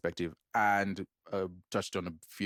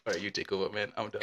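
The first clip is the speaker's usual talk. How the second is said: ordinary talk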